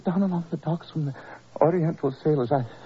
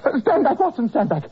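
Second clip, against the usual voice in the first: high, loud cry to keep him away; frantic warning; 8 semitones higher, 5 dB louder than usual. Acted. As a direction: shout him back, desperate. Sick man warns friend off.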